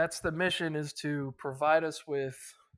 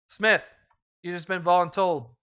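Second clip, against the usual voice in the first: louder, higher call across the squad; volunteer Smith with a hearty bark; 3 semitones higher, 9 dB louder than usual. Acted. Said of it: "Brian goes".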